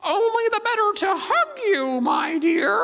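very high, falsetto granny voice; a wolf squeaking sweetly as grandmother; wolf disguised as grandma. Acted. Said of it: "And the wolf says".

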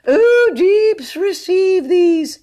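very high, loud declaiming; shrilly high, drawn-out recitation; high-pitched voice. A narrator's reading. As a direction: launch slowly into a pompous ode. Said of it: "began in his high-pitched voice".

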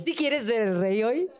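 put-on slow Mexican accent. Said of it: "aparezco en plan".

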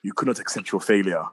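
low, menacing villain line; a hint of a calm, cold villain; lightly voiced Thanos. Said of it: "and he goes".

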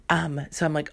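ordinary talk

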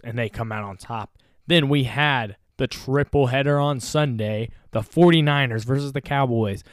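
ordinary delivery

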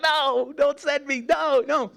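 put-on high, wailing little boy pleading; mock bawling child. Preacher imitates himself crying at the bus stop. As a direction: whine it like a crying four-year-old. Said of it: "I'm bawling my eyes out".

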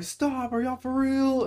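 high squeaky freaked-out voice; high-pitched mock panic; mimicking a spooked sister. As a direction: squeak it like a scared girl.

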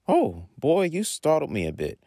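high-pitched startled exclamation; a little shocked. A narrator's reading. Mother startled by son bursting in, mild shock. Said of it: "says with a little shock".

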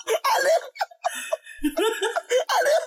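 high-pitched laughing. Real, not acted.

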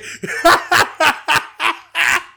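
bursting into a high loud laugh. Real, not acted. Laughs at own joke.

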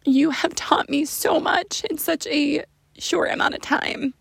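mother whispering thanks to her daughter; tender whisper. Real, not acted.

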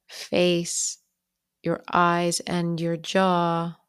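unhurried meditation guide, long pauses between phrases; calm, even-pitched bedtime guidance with lingering pauses; guided bedtime meditation. Real, not acted. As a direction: ease the listener toward sleep, phrase by phrase.

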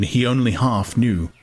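ordinary delivery, nothing marked

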